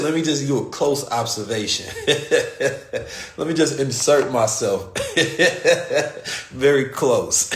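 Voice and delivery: laughing through the words; chuckling at his own joke. Real, not acted. Telling friends, laughing while he speaks. Joking about close observation.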